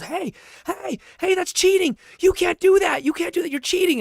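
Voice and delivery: put-on high, loud child's voice protesting; yelling kid. Retelling how the opponent's son accused him of cheating, high kid yell. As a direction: squeal it like an outraged little boy. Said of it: "her son started to yell and goes".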